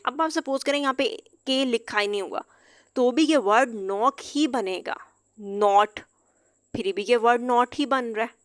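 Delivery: ordinary talk, nothing marked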